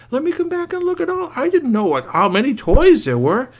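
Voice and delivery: put-on very high, thrilled child voice; amazed kid. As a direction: gasp with delight at the toys. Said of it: "Curtis said".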